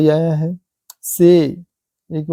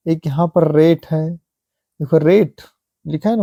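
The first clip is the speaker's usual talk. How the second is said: ordinary delivery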